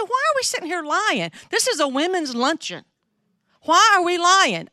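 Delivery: high, pleading challenge to friends; mock exasperated protest. Asking women at the luncheon why they lie, exasperated. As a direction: call out the pretence, hammed-up and high. Retells confronting the lying women.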